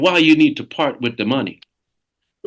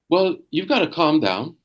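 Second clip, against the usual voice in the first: ordinary talk, nothing marked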